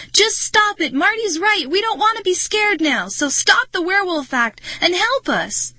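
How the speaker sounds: very high, loud, desperate demand; frantic high-pitched outburst; screaming. A narrator's reading. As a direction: scream at them to quit. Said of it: "I screamed".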